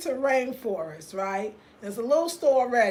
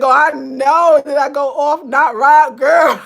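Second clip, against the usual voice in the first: very loud, higher-pitched exclaiming; loud outburst; 4 semitones higher, 15 dB louder, slower than usual. Real, not acted. Answering a viewer about going off, very loud exclaiming. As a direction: burst out at full volume.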